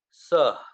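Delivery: सह as one short syllable ending in a puff of air; visarga breath in सह. Shown as a demonstration. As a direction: say sa, then just release breath for the ह in सह. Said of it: "बस हवा है जो हमारे मुंह से निकलती है".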